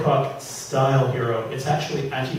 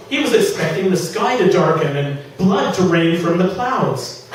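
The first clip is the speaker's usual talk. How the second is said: lively dramatic reading of a comic fantasy; story reading; 3 semitones higher, 5 dB louder than usual. A narrator's reading. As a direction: read it to the room, savouring the jokes. Reads his novel aloud.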